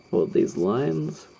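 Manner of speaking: slow, words stretched by a yawn. Real, not acted. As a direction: talk through a big yawn. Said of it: "That was me yawning like a Tyrannosaurus".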